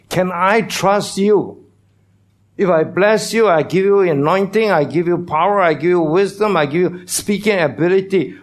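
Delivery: high, loud preaching as God's voice; voicing God. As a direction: ask it as God pressing the listener. Voicing God's question in sermon.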